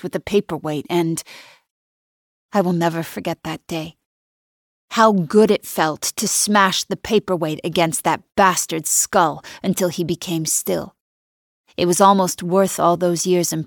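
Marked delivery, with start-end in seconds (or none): none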